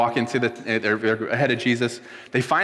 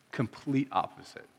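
much softer, voice close to breaking; choked up, barely holding it together; holding back tears; 10 dB quieter than usual. Real, not acted. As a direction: fight the tears on these words. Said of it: "I told myself I wasn't gonna cry".

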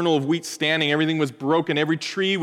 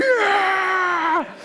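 whole army shouting yeah; crowd roar. Retold or imitated. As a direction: cheer like an army roaring back. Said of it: "And everyone's like".

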